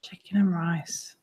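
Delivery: whisper. Real, not acted. Mocking friend's dish whisper.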